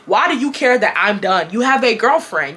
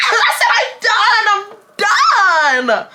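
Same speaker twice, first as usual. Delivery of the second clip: high, drawn-out shout; hammed-up high yell with a laugh in it; mock yelling back; 11 semitones higher, 3 dB louder, much slower than usual. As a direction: holler it slowly, stretching DONE. Acted yelling match on phone.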